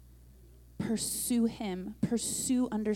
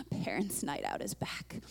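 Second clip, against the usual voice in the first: playful whisper; 7 semitones lower, 7 dB quieter than usual. Real, not acted. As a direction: whisper it like a fun secret, lower and soft. Playful announcement after a laugh.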